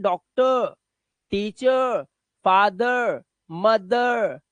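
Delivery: डॉक्टर, टीचर, फादर, मदर each ending in uh. Shown as a demonstration. Teacher models word list, endings on uh. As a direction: let the final r of each word melt into uh. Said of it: "उसमें अ की आवाज देते हैं".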